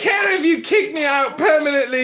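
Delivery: high, defiant put-on child voice; son impersonating father. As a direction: dare them as a high-voiced little boy. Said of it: "You wanna hear one of my sons impersonate me?".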